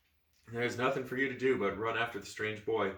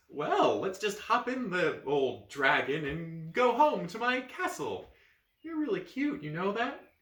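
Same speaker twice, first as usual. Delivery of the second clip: flirty; 7 semitones higher, 3 dB louder, slower than usual. Acted.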